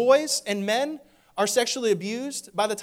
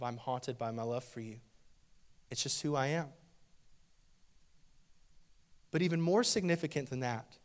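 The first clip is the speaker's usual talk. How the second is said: low, hushed, loving shepherd speaking to his flock; tender voice of Jesus; 5 semitones lower than usual. Acted. Pastor voices Jesus softly.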